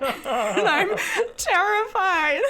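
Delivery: high, slow, through laughter; laughing dread. Real, not acted. Dreading the accent's return, high and laughing. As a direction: squeal it slowly while giggling. Joking dread while cracking up.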